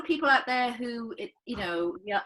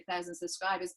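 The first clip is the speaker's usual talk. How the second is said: ordinary delivery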